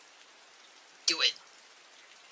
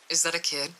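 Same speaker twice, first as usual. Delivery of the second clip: plain reading voice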